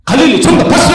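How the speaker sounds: loud, high burst of approval; excited quiz host. Real, not acted. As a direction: announce the winning answer and call for applause. 정답 맞힌 청중에게 박수 유도.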